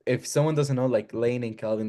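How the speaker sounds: ordinary delivery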